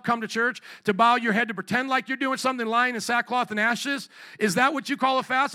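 ringing pulpit questions, building in force; preaching scripture. Real, not acted. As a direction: declaim the verses to a congregation. Preacher reads scripture emphatically.

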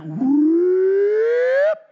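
loud, high whoop sliding upward; rising whoop. Retold or imitated. Preacher jokes to congregation about a surprising sight, rising comic whoop.

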